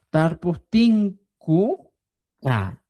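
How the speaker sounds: "Tar pu tin ku ka" slow with syllables apart; slow classroom syllable build. Shown as a demonstration. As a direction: build the word slowly piece by piece. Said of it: "vamos a agregar".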